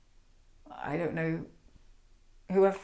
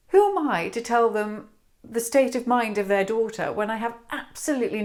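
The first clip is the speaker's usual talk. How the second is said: raised, louder, impassioned self-questioning; distressed outburst, higher and more forceful towards the end; anguished self-doubt; 4 semitones higher, 5 dB louder than usual. Acted. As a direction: question yourself with mounting agitation. Acted anguished self-questioning over daughter.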